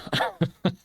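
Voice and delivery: a quick rueful laugh; short laugh. Real, not acted. Laughing at skipping school effort, short rueful laugh.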